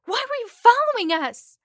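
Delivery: high, loud panicked shout; screaming. Acted. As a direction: keep screaming at the captor. Blonde screaming after scream.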